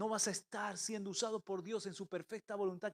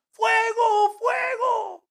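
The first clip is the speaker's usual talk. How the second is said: frantic yell of fire, much higher and louder; boy yelling fire in a smoke-filled house; panicked shout; 15 dB louder, much slower than usual. Retold or imitated. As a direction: scream it in a blind panic. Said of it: "empezó a gritar".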